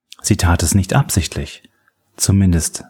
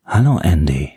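lightly voiced stranger on the phone; a hint of a low male stranger; unknown man's voice; 3 semitones lower than usual. A narrator's reading. Unknown man answers Andy's call, lightly voiced stranger. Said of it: "antwortete ihm eine unbekannte Männerstimme".

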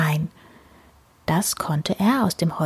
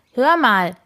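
mildly raised, higher call; 4 semitones higher than usual. A narrator's reading. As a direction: call for attention, pitched up. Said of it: "rief sie". (rufend, leicht angehoben)

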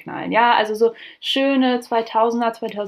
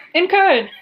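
punchline; 8 semitones higher, 5 dB louder than usual. Acted. Comic punchline answer.

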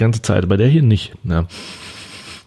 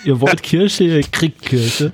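higher, slow, boastful voiced drink; bold drink voice; 5 semitones higher, much slower than usual. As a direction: speak as a cocky cherry soda. Voicing the cherry lemonade.

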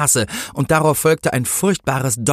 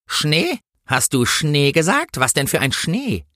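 raised pitch, pricking up her ears; suspicious policewoman; 6 semitones higher, slower than usual. A narrator's reading. Policewoman grows suspicious about snow, higher and probing. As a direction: question it with sudden sharp interest. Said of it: "Da wurde die Polizistin hellhörig".

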